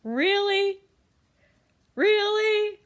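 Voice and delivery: exasperated at the soaked blankets; high loud incredulous really. Retold or imitated. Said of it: "And I was like".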